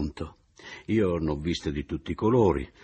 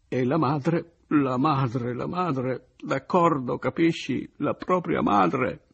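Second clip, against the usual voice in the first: Oliva sconvolta; 8 semitones higher, 4 dB louder, slower than usual. Acted. Oliva sconvolta accusa la madre.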